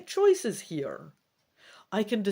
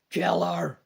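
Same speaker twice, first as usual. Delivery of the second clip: a louder, harsh monster yell; yelled order; 7 dB louder than usual. Acted. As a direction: bark the kill order at the troops. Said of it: "yells yet another of the glowing lava creatures".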